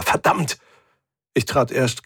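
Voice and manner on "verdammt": higher, more forceful "verdammt"; angry repeated curse. A narrator's reading. Captain kicks the shuttle seats, cursing angrily. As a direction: curse with mounting frustration on "verdammt". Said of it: "und wieder".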